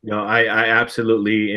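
ordinary delivery

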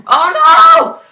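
high panicked cry of shock; shocked scream. Real, not acted. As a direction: yelp it out in sudden alarm. Sudden fall in game.

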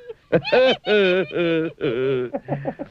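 high, gleeful giggle; a high bubbling laugh; giraffe laughing. Acted. Giraffe laughs itself into a knot.

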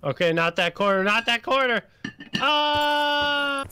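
high, loud alarm rising to a scream; panicked shouting. Real, not acted. Panicked calls during game drop.

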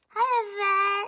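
high, loud pretend-kid greeting; put-on squeaky little voice; little sibling voice. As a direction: squeal hello like a small child.

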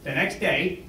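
ordinary delivery

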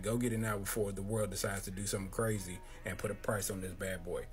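ordinary talk

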